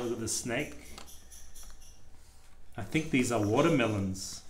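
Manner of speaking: plain reading voice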